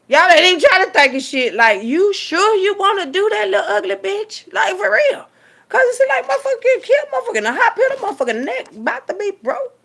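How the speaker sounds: high, loud, worked-up preaching; fired-up tirade; heated rant. Real, not acted. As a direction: rail at them full force.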